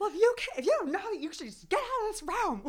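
high, loud, laughing fake fury; mock exasperated outburst. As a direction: blow up in a hammed-up rage. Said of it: "it's like".

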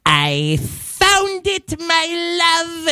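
very high, slow and drawn out; mom impression. Son imitating his Moroccan mom, high slow voice. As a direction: stretch it way up high like a doting mom. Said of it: "how she says it".

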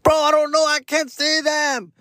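mock falsetto whine, slow and high; high, slow mock-helpless voice. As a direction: play himself as a clueless, whiny old guy. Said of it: "I'm like".

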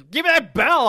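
high, loud demanding yell; loud shout. Retold or imitated. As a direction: shout it out wildly. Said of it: "And he was".

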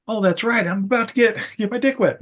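high, put-on character voice; goofy movie hero. Alex answering his buddy about meeting Emma, goofy. Voicing Alex's reply, crude parody.

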